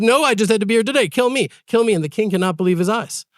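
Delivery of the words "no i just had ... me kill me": urgent pleading. Retold or imitated. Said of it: "He says".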